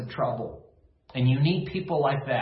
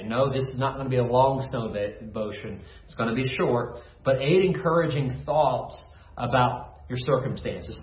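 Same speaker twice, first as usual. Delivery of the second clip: normal talking voice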